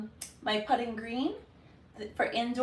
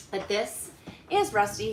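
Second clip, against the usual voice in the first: ordinary delivery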